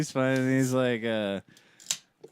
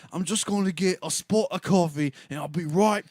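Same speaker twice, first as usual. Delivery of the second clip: high mock British accent with a tacked-on mate; hamming up a British guy asking for coffee time; 7 semitones higher, slower than usual. Retelling the British driver begging the attendant, mock British accent. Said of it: "he kept throwing that at the end".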